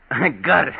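laughs out the words; triumphant laughing reply. Acted. Laughing after swatting.

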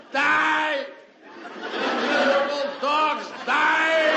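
shouted high, slow on miserable dogs; yelling. Retold or imitated. Seven guys cheering the killing at the movie, shouting high and loud. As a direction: bellow it at the screen like a bloodthirsty crowd. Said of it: "The seven guys are yelling".

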